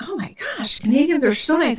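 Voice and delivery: high, swooning retold delight; hammed-up starry-eyed amazement; mock gushing. Gushing reaction to cop, retold.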